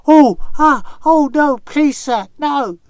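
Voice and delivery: loud, high, hammed-up cries for mercy; mock terrified pleading. Anansi faking frightened villagers outside the cave, loud high pleading. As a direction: beg in mock panic. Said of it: "made lots of noise like".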